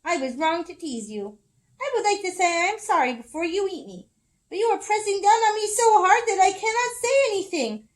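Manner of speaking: pinned pleading. Acted. Squirrel squashed under Bear's paw, high strained plea. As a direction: plead breathlessly, rising high.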